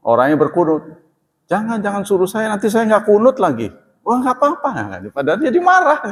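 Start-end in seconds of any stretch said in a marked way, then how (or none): 1.5-3.7 higher, politely declining voice; polite refusal. Retold or imitated. Congregant declining to lead prayer. 4.1-4.7 much higher, polite it's-fine; polite reassurance. Retold or imitated. Host's polite reply.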